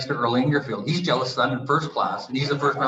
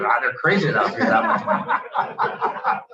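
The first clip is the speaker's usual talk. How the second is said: laughing. Retold or imitated. Laughing dad quote.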